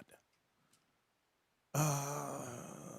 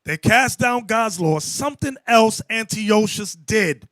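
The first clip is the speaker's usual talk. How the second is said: high, raised preaching voice; forceful preaching; 6 semitones higher, 5 dB louder than usual. Real, not acted. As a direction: drive the lesson home to the congregation, loud and high.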